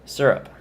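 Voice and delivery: "Syrup" said quickly as SUR-up; first vowel of "Syrup" as in "sir"; SUR-up pronunciation. Shown as a demonstration. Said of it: "pronouncing it correctly".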